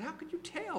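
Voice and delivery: can't believe she could tell; incredulous. Retold or imitated. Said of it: "I said".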